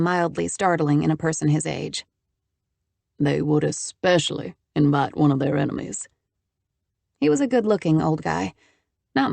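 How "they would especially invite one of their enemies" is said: put-on low, dry older man. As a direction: answer dryly with a knowing edge. Old man's dry reply.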